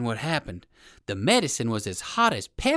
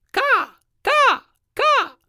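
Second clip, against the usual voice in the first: crow cawing; 9 dB louder, much slower than usual. Acted. Crow's call voiced.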